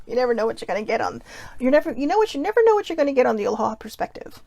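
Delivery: usual speaking voice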